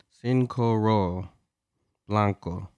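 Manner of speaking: slow, drawn-out mock Spanish pronunciation of "Sin Coro Blanco"; mock Spanish accent. Reading the tequila label aloud on the podcast, mock Spanish accent. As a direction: roll "Sin Coro" slowly like a Spanish speaker, playful. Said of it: "Why you gotta do the accent?".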